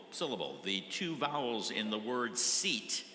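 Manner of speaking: ordinary talk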